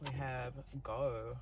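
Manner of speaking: ordinary talk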